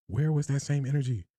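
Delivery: ordinary delivery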